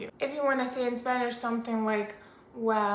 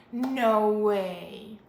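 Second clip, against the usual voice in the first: loud, drawn-out disbelief; loud, mock-scandalized reaction; shocked reaction to gossip; 5 dB louder than usual. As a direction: react as if a friend just spilled juicy gossip. Acted reaction to juicy gossip.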